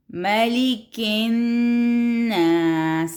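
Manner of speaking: slow chant, doubled n in الناس held; slow tajweed recitation; recitation with shadda. Shown as a demonstration. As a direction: draw out ملک الناس slowly. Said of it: "نون کے اوپر پھر شد آ گئی".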